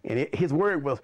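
usual speaking voice

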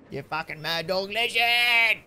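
slow, loud, hyped-up praise. Real, not acted. Streamer hyping up a donor, slow loud praise. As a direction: stretch it out like a hype man.

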